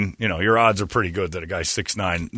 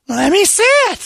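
squeaky excited imitation; high eager woman voice; 6 dB louder than usual. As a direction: play her high and eager.

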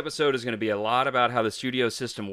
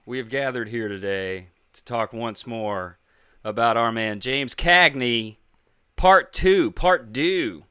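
announcer voice; 4 dB louder than usual. Acted. Grand announcer opening.

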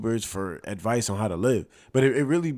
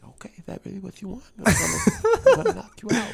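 high, fast lispy boxer voice; Mike Tyson impression; 12 semitones higher, 7 dB louder, faster than usual. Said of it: "he go, like".

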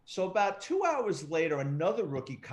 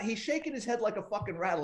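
usual speaking voice

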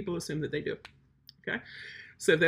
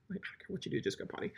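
whispered aside; 13 dB quieter, faster than usual. Real, not acted. Quiet aside.

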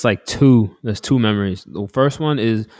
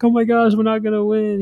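panicking mom again. Retold or imitated. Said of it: "And she was like".

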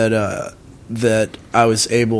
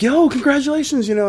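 high, imitated friend's excited call; excited congratulations. Friend phoning the new dad, excited congratulations.

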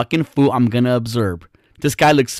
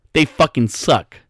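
ordinary delivery

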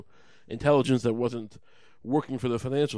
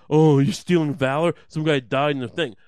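much louder mocking outrage; 9 dB louder than usual. Comic mocking valor-obsessed veterans, loud outrage. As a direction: bellow it like an offended vet. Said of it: "It's like".

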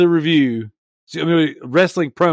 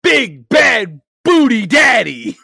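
ring announcer; 7 semitones higher, 5 dB louder, slower than usual. Acted. Announcing wrestling gimmick name.

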